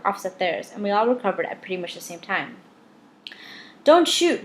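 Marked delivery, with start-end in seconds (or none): 3.8-4.4 high, loud panicked yell. A narrator's reading. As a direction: shout to stop the gun. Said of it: "Rudy yelled".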